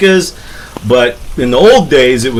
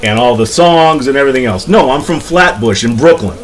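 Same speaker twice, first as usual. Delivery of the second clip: put-on Brooklyn werewolf's New York accent; New York accent, Flatbush style. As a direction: put it right in broad New Yorkese. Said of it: "a New York accent".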